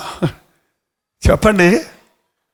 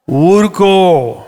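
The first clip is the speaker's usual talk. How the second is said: soothing hush; much slower than usual. Acted. Soothing command to stop crying.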